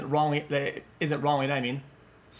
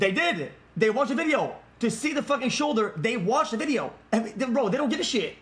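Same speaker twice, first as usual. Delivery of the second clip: higher-pitched exasperated outburst; heated contradiction; 4 semitones higher than usual. Real, not acted. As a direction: argue back heatedly, voice pitched up. Streamer heatedly contradicts the video.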